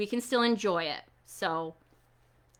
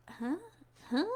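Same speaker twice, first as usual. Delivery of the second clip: confused huh; 3 semitones higher, 9 dB quieter than usual. Shown as a demonstration. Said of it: "let me see your confused face".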